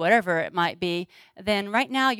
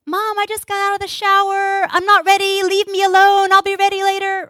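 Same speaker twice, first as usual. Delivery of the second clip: put-on much higher and louder, a bratty teenage girl; whiny teen daughter; 10 semitones higher, 8 dB louder than usual. Teen daughter snapping at mom from the shower, high and whiny. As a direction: push mom away, whining. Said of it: "this is how it would look in my house".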